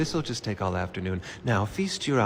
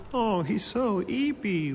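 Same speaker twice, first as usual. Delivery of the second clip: put-on high, slow, gushing baby talk; baby talk coo; 10 semitones higher, slower than usual. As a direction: coo over a sleepy baby. Improv, cooing over sleepy baby.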